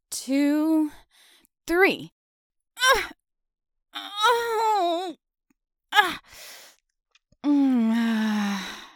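high, loud straining cries; tug of war. Acted. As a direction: cry out while being pulled hard. Neighbour pulls her through the window.

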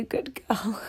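breathy pleading whisper; hushed, submissive whisper. Acted. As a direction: drop to a scared whisper. Pleading roleplay, sudden whisper.

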